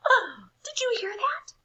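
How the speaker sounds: very high, startled cry; high excited cry; cried out. A narrator's reading. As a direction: suddenly alert at the bell. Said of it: "cried Wendell".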